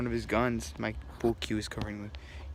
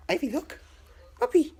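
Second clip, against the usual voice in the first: much higher voice, singsong call to the dog; high coaxing call; 6 dB louder than usual. Real, not acted. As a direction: coax the puppy to look at the camera. Calling the dog for camera.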